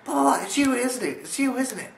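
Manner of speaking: very high loud accusing voice; accusing friend. Retold or imitated. Friend phoning to accuse him of being the gnome, high loud. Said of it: "she calls me up ... says".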